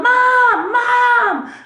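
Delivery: put-on high, loud, drawn-out kid's yell; yelling for Mom. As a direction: mimic a child calling out for Mom at full pitch. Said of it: "starts yelling".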